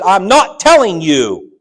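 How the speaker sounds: loud, slow, final refusal; retold yell. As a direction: bark it out like a stubborn father. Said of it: "he yelled at me".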